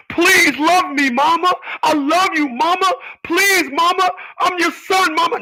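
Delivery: a hammed-up pleading little boy; mock begging son. Mocking sons who beg their mothers for love, whiny.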